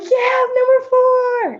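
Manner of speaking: very high, drawn-out fan holler; mock loud cheering. Sidelined player showing how she'll embarrass her friend, mock fan cheering. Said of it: "I'm gonna cheer so loud for her".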